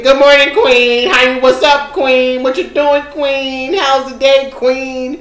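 imitating an over-keen admirer, mockingly; mocking repetitive greeting. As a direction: parrot the same greeting, exasperated. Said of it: "why are you saying queen every thirty seconds".

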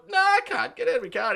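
high-pitched, loud refusal; mock angry shout. Car owner refusing the driver, angry shout. Acted quarrel over the car.

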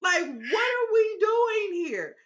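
high, loud, slow exasperated question; outraged disbelief. Real, not acted. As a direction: demand an answer from the screen. Outraged at the movie ending.

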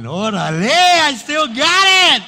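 cocky inner voice drawn out; smug inner boast. Retold or imitated. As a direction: savor it like you still got it. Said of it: "inside I'm going".

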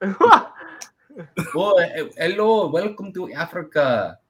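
high-pitched, hammed-up African accent; comic fake African welcome; mock African accent. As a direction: greet visitors in a put-on African accent. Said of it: "to the Africans, I became African".

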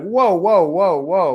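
loud, high run of mock-alarmed whoas; alarmed dad. Dad halting his lovestruck seven-year-old, mock alarm.

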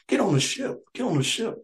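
high coaxing nun voice; imitating the nuns. Comedian voicing the nuns luring villagers onto the ship, high and coaxing. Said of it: "the nuns are telling me to get on the ship".

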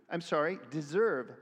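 ordinary talk

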